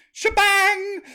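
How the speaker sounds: Shebang! shouted high and loud, savoring it; relishing the word. Shown as a demonstration. Host savours the word shebang, shouting it high and loud. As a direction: belt out Shebang! with glee. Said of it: "that's a good word".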